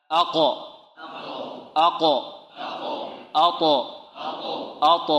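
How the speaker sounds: each of أق، أط، أب، أج، أد bounced off its last consonant; short separate syllables, final consonant of أق، أط، أب popped without a vowel; echoing qalqalah. Shown as a demonstration. Teacher has students repeat after him, bouncing qalqalah. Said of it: "أقرأها وتردون خلفي هكذا".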